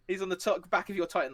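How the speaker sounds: ordinary talk, nothing marked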